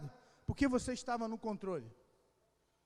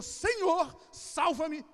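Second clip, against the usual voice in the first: high, loud cry to the Lord; acted shout; 5 semitones higher, 6 dB louder, much slower than usual. Sinking Pedro calling to the Lord, loud shouted plea. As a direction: cry out to be saved. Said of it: "Quando Pedro gritou".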